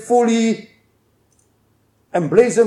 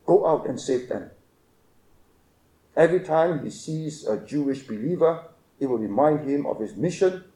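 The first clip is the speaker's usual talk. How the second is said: soft, lowered commissioning words; hushed preaching; 7 semitones lower, 9 dB quieter than usual. Acted. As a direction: speak the charge gently and low.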